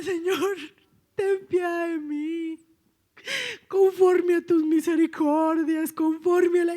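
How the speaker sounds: loud, high, drawn-out wailing prayer; retold tearful plea. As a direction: re-enact crying out to God for mercy. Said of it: "llorando en mi camita".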